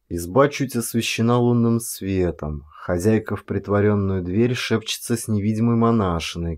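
ordinary delivery

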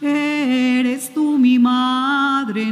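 normal talking voice

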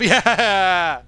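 loud, high laughing "yeah"; gleeful laughing cheer. Real, not acted. Gamer cheering that the hit got off, gleeful laughing shout. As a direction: burst out in a triumphant laugh. Celebrates a successful play.

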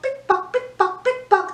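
high, bright pick-pock clicks; pick-pock said high like clicking shoes; clicking heel sounds. Shown as a demonstration. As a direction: tap out the heels like a sound effect. High heels sound effect.

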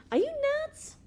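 very high, loud, incredulous cry; outraged high-pitched squawk; explosive outburst. A narrator's reading. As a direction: explode with disbelief, pitch shooting way up. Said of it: "exploded Bigman".

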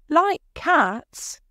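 high, loud indignant mouse cry; high, angry. A narrator's reading. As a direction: squeal it in offended outrage. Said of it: "cried the mouse in a high, angry voice".

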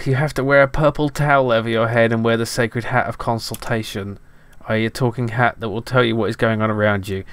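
mock-solemn storybook voice; mock epic narration. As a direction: ham up the old game text. Reading game narration aloud.

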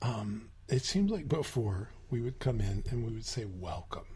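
drops low and soft into the remembered welcome; quiet, low retold greeting. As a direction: hush the memory low and soft.

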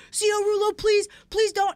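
high, loud begging voice; pleading inmate. Retold or imitated. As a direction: imitate a panicked inmate begging the officer.

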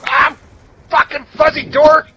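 loud frustrated yell; raging gamer. Real, not acted. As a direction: yell at the screen in rage.

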